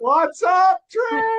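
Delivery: high, loud whoop of hello; exuberant greeting. Real, not acted. Host spotting Trish on the call, exuberant greeting. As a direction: call out in a soaring pitch.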